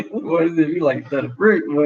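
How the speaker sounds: ordinary talk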